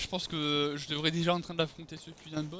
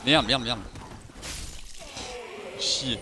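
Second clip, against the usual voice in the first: raging gamer; slower than usual. Real, not acted. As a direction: curse hard at the game. Dies again, swears.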